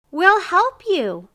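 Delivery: slow, high, loud offer; emphatic interruption. A narrator's reading. Neighbor breaks into miser's protest, emphatic. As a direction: call it out to cut off the complaint.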